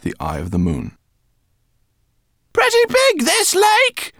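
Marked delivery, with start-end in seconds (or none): 2.5-4.1 high, loud rat voice over the engine; Lola shouting. A narrator's reading. Lola shouts to Twigleg over the plane engine, high and loud. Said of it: "shouted Lola".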